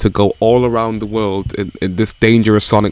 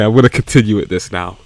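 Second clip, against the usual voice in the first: usual speaking voice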